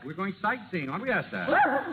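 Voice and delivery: talking to the dog. Acted. Nick talks to his dog.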